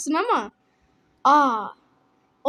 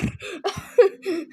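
higher-pitched burst of laughter; short breathy giggling burst; real laugh; 4 semitones higher than usual. Real, not acted. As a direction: crack up at the mishap. Laughs at game mishap.

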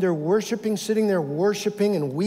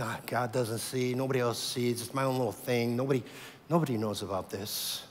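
secret inner voice; 5 semitones lower, 5 dB quieter than usual. Acted.

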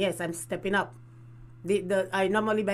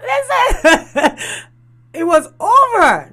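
laughing; 9 semitones higher, 9 dB louder, much slower than usual. Real, not acted. Laughing hard at her own breakup story.

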